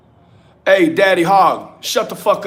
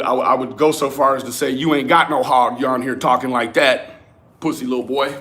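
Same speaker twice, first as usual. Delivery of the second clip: ordinary delivery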